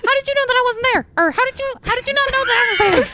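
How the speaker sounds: high, fast squirrel chatter. Acted. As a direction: talk like a panicky squirrel. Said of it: "the squirrel voice is too good".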